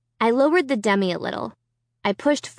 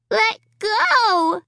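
put-on high little-girl voice, insisting; a small child digging in her heels; 11 semitones higher than usual. A narrator's reading. Little Alicia tells the puppet to let go, insisting. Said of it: "she insisted".

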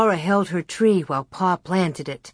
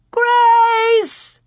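very high, loud, drawn-out cry; shrill call; 6 dB louder than usual. A narrator's reading. Ma calling through the slough grass, shrill. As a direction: call shrilly across the distance. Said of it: "even Ma's shrill call".

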